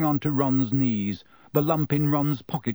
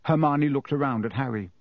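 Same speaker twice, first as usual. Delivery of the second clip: ordinary delivery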